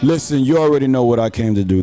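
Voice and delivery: ordinary talk, nothing marked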